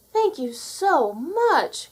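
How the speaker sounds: high, very slow, overjoyed thanks; delighted cry. A narrator's reading. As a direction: gush with joy at the gift. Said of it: "cried Cinderella".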